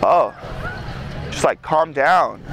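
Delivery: low, slow, soft soothing words; reassuring calm. Retold or imitated. Jesus comforting the crying young man, low soft reassuring voice. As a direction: gently settle someone who is sobbing.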